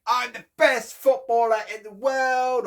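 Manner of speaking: high, loud playground brag; mock boasting child. Child bragging in the playground, high and loud. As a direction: show off like a kid in the playground. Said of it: "running around saying".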